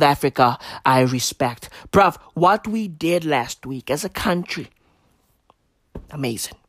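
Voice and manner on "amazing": hushed awe. Acted. Awed praise after pause.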